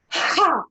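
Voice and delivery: loud high Ha blasted on the breath out; shouted Ha. Shown as a demonstration. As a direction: shout Ha from the belly. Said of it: "Breathe in".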